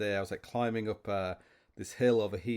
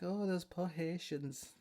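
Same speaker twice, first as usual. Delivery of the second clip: mock wife; 8 semitones higher, 7 dB quieter, slower than usual. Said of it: "She was like".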